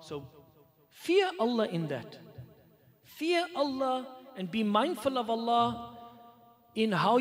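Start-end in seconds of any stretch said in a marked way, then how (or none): none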